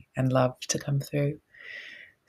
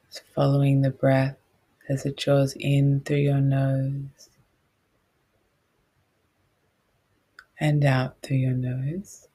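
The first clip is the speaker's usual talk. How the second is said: guided meditation; 4 dB louder than usual. Real, not acted.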